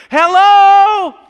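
shouted, high calls to be heard; God screaming. Acted. Preacher voicing God shouting to the country's leaders, very loud and high. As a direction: bellow it out as God calling to a deaf nation. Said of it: "he's screaming into the lives".

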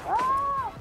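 ordinary delivery

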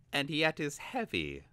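put-on high, eager young apprentice voice; Vilnix voice.

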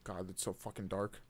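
ordinary talk

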